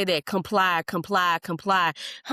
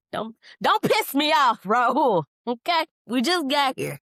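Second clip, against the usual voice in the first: louder, sharp real anger; angry warning; 5 semitones higher, 3 dB louder than usual. Real, not acted. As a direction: warn him off in earnest. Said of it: "rage baity persons".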